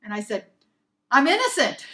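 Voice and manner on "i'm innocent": loud, raised, forceful claim; really strong declaration. Retold or imitated. Employee finally answering her boss, strong and loud. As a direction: declare it at full strength. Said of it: "I said that really strong".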